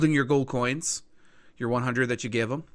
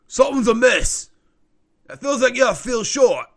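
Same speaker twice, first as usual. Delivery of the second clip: higher, louder dealer sensing a cheat; suspicious informant; 10 semitones higher, 9 dB louder than usual. Acted. Informant counting gold finds it short, suspicious. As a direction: call out the short payment suspiciously. Game master voices the informant.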